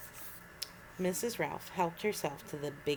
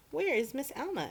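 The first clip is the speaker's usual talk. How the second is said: puzzled asking; 9 semitones higher than usual. A narrator's reading. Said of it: "everybody asked".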